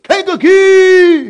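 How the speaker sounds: God calling out "Kankakee!" to a man alone. Retold or imitated. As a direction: boom "Kankakee!" slowly like a voice from heaven. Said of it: "One time God said".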